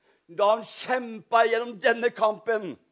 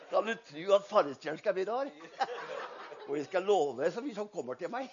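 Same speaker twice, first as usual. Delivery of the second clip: laughing; 4 semitones lower, 6 dB quieter, faster than usual. Real, not acted. Joking story, laughing through.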